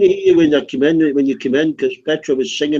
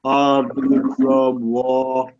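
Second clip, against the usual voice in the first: each word dragged out extremely long; exaggeratedly slow; much slower than usual. Real, not acted. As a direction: repeat it as if to someone hard of hearing, very slowly. Repeats slowly for someone not hearing.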